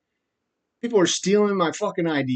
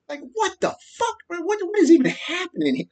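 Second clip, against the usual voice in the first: much higher, strained voice of utter disbelief; incredulous squeak; 14 semitones higher than usual. Pretend. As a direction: squeal it like you can't believe it. Disbelief at sixty hitmen.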